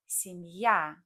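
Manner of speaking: семья said sem-YA, stress on the final ya; semYA, hit the ending. Shown as a demonstration. Teacher shows learners: семья stressed on the ending. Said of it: "would have stress there".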